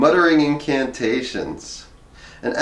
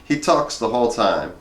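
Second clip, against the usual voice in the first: ordinary delivery, nothing marked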